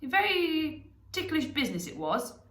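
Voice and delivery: a hint of an old wizard; lightly voiced wizard. A narrator's reading. Gandalf recounts the rescue.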